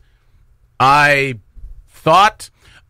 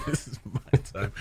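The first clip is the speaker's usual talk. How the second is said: laughing; 3 semitones lower, 13 dB quieter than usual. Real, not acted. Laughing echo of partner.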